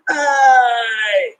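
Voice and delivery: demonstrating a sharp pained cry. Shown as a demonstration. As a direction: cry it out for the audience to copy. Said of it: "can you help me and do this with me".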